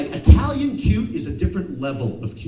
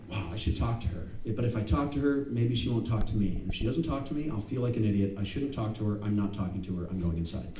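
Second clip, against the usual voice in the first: soft, lowered voice; subdued muttering to yourself, sinking lower; self-doubting inner monologue; 4 semitones lower, 5 dB quieter, faster than usual. Acted. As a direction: talk yourself out of it, quietly. Talking himself out of approaching her.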